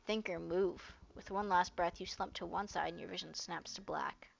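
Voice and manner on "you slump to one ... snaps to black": fading whisper. A narrator's reading. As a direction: let the words fade out to a whisper. Fainting narration.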